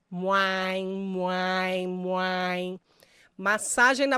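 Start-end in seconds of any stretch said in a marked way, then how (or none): 0.1-2.8 open-mouthed drawn-out "muai" sounds repeated; warm-up mouth sound. Shown as a demonstration. Voice coach showing students a warm-up sound, drawn-out open "muai". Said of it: "abre bem a boca e solta esse som".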